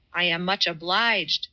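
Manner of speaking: plain reading voice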